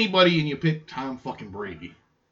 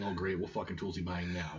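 much softer, lower dry "Oh, great"; sarcastic wife; 6 semitones lower, 8 dB quieter than usual. Retold or imitated. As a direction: mutter it sarcastically. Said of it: "She's saying".

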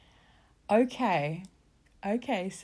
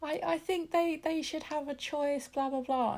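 high, prissy mock politician voice, much higher pitch; mocking Esther McVey; 12 semitones higher than usual. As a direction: squeak it out like a smug MP dodging the question. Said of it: "and she was like".

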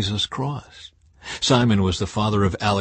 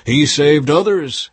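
slow, louder sneering remark; scoffing; 5 semitones higher, 7 dB louder than usual. Acted. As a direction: draw it out with scorn. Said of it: "they scoffed".

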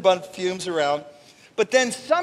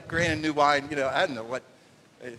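ordinary delivery

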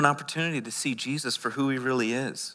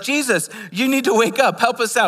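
high, loud plea to wake Jesus; frantic call for help; 10 semitones higher, 7 dB louder than usual. Acted. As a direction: shout it like panicking disciples in a storm. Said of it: "They say, like".

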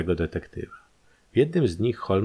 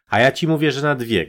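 loud, high-pitched arguing; agitated and emphatic, voice raised; heated insistence; 7 semitones higher, 7 dB louder than usual. A narrator's reading. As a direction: argue hotly, refusing to give in. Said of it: "gorączkował się Jan".

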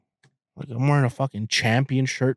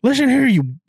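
much higher, louder hammed-up Southern accent; mock Southern threat; 12 semitones higher, 5 dB louder than usual. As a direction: square up like an angry Texan customer. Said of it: "something like".

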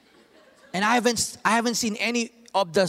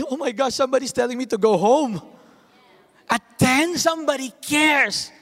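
a touch higher, delighted; 5 semitones higher than usual. Retold or imitated. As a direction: marvel at being cared for. Retold joy at curfew.

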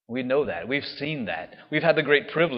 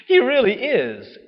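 laughing; 11 semitones higher, 7 dB louder, slower than usual. Real, not acted. Laughing after the story.

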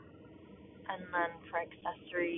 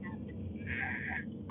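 hoarse, cracking voice; voice giving out; 6 semitones lower, 7 dB quieter than usual. Real, not acted. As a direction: voice cracking and failing. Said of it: "I'm, like, losing my voice".

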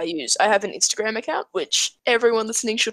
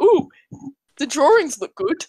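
awed; 9 semitones higher, 5 dB louder than usual. Retold or imitated. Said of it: "I'm like".

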